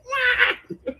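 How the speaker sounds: high laughing yelp; mock startled yelp. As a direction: squeal it with a laugh. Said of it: "I'm just like".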